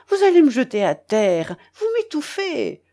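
protest, rising sharply at the end; flustered protest. A narrator's reading. Old man nearly knocked over by hug, flustered protest.

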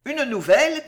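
a hint of nervous agitation; agitated. A narrator's reading. Lady nervously announcing news to the sick servant, agitated. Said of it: "continua la dame toujours plus agitée".

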